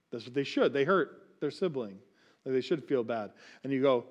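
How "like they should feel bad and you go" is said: usual speaking voice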